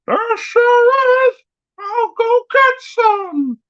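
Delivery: high, slow goofy falsetto; Pud's voice. Retold or imitated. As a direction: answer in a drawn-out silly high voice. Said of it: "old Pud's just like".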